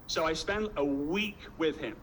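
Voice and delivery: usual speaking voice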